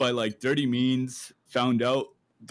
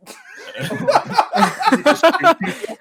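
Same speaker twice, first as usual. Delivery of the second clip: high, loud burst of laughing; real high-pitched belly laugh; loud laughter; 10 dB louder than usual. Real, not acted. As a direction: crack up hard at the joke. Cracks up at Texas joke.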